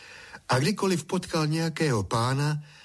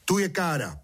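higher-pitched pointing-out; řka, ukazuje káru; 6 semitones higher than usual. A narrator's reading. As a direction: state it in a raised voice to a farmhand. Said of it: "řka". (vyšším hlasem ukazovat pacholkovi)

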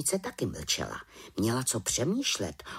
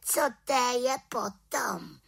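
high little-witch retort; 13 semitones higher than usual. A narrator's reading. Little witch answering the raven back, high-pitched.

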